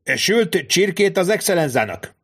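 high call out down the corridor; shout. Acted. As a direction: call it outward at full throat. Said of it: "teli torokkal kiáltott kifelé". (magas hangú kiáltás kifelé, teli torokkal)

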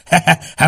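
high loud cackle; hammy he-he-he laugh; mocking laugh. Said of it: "Mail felröhögött".

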